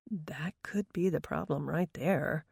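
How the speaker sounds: slow breathy whisper; whispered thought. Acted. Darren's unspoken thought.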